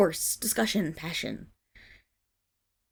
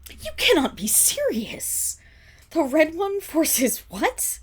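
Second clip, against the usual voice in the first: slow, higher, aghast; scandalized slow cry; shocked disbelief; 8 semitones higher, 4 dB louder, slower than usual. Acted. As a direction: drag it out in outrage. Learning the stones' powers.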